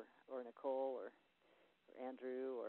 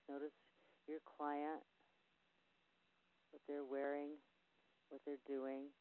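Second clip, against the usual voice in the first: guided visualization. Acted. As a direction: lead them gently through a spa-like relaxation.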